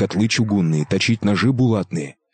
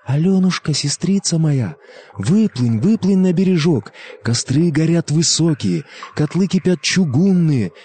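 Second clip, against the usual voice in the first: plaintive calling; 7 semitones higher than usual. Acted. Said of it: "жалобнёшенько зовёт".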